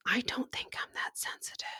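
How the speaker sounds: soft breathy whisper of denial; hushed, half to herself; whispered aside. Real, not acted. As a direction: drop to a secret whisper. Aside denying her sensitivity.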